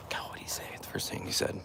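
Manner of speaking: whispering to himself, trying to recall; hushed, thinking aloud to the chat. Real, not acted. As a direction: murmur it under your breath. Hushed recap after meeting stranger.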